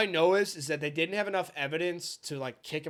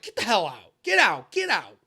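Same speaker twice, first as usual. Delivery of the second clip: louder, rising barked get out; imitated yelling order; 4 semitones higher, 7 dB louder than usual. Instructor ordering them out, a loud imitated yell. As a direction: bark it at the recruits. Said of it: "He's just like".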